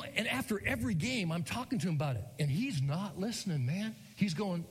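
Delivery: ordinary talk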